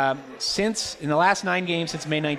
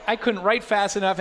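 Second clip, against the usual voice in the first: ordinary talk, nothing marked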